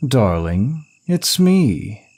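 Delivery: high, lilting call. Acted. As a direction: call out to someone hiding, pitch swooping up. Calling out to hidden lover.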